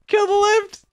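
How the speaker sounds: very high, frantic cry; frantic call. Real, not acted. Player pleading to kill the lift, frantic. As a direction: squeal the order in panic.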